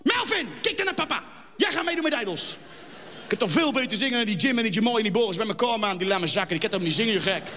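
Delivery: put-on broad Utrecht accent, pushy father; lightly voiced Utrecht dad pushing his son. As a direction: plat Utrechts vader. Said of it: "Stel je voor, een Utrechtse jongen".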